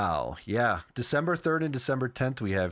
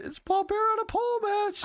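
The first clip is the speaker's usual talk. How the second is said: mock squeaky voice, laughing. Mocks in high voice.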